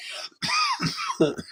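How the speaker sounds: hard dry cough; coughing mid-sentence; coughing fit. Real, not acted. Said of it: "I was coughing".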